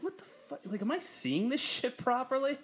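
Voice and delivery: very high, half-breathless what the fuck; squeaky incredulity; stunned disbelief. Retold or imitated. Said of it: "I'm like".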